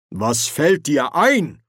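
Ridcully sternly rebuking the dean. Acted. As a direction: put him in his place, slowly and firmly. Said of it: "erwiderte Ridcully streng".

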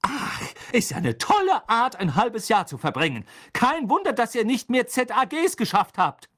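high, loud scornful retort; angry sarcasm. Acted. Mother mocks twins' wasted half year, angry high sarcasm. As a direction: scold with biting mock praise.

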